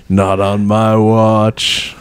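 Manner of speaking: very slow, lightly voiced mayor; slow, deliberate stoner mayor; stern mayor voice. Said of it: "Mayor Cheech Marion".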